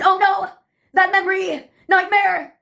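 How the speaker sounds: put-on high-pitched theater-kid outburst; theatrical scream. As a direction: play it like a melodramatic musical chorus. Recurring theater-kid chorus.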